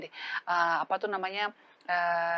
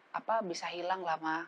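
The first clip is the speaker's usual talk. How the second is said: normal talking voice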